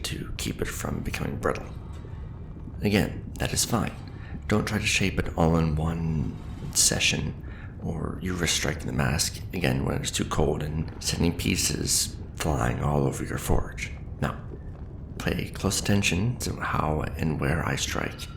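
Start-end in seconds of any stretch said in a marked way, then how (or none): none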